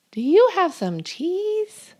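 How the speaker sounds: high, pleading little mouse; squeaky mouse voice. Acted. The giant mouse asks the listener, high and squeaky. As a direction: beg for cheese like a tiny mouse.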